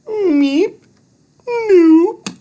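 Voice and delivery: very high squeaky creature voice; little alien. Acted. Thud correcting Andrew about Harley, squeaky and high. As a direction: chirp it like a tiny alien.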